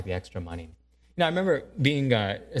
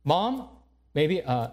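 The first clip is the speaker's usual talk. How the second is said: usual speaking voice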